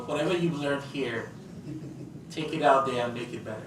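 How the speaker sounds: ordinary delivery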